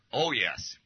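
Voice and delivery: a mild Cockney "Oh, yes"; a hint of Cockney; Cockney accent. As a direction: voice the London girlfriend's eager Cockney yes. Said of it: "in a Cockney accent".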